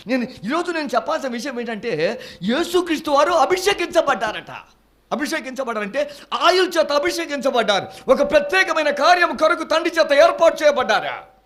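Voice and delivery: loud, high, fervent preaching. Acted. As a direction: preach it out to the congregation. Preacher at full force. (గట్టిగా ఉత్సాహంగా ప్రసంగం)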